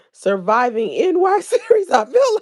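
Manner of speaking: loud, high, laughing read; bursting out laughing. Real, not acted. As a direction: lose it midway through reading. Cracks up reading comment.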